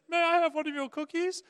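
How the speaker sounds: put-on high, loud polite little kid; squeaky child asking sweetly. Kid asking the bearded ogre for a cookie, high.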